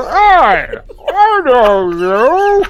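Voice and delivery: loud, high, very slow call; mock cheerful yell to a stranger; bathroom prank call. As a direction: bellow it through the stall wall. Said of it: "and you go".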